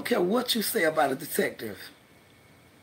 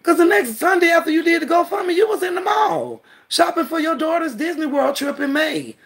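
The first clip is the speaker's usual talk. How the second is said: higher, louder angry ranting; furious call-out; 11 semitones higher, 5 dB louder than usual. Real, not acted. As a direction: rip into her, loud and high. Calling out rival online.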